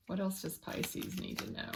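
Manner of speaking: hushed, voiceless asking of spirit; soft private whisper to an unseen guide; whispered question. Real, not acted. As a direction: whisper it to the spirits, almost to yourself. Quietly asking spirit for more.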